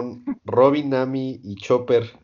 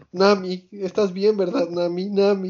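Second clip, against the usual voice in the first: higher, doting lovesick voice; mock-swooning over Nami; lovestruck Sanji; 7 semitones higher than usual. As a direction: fuss over her like a smitten cook. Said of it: "solo dice".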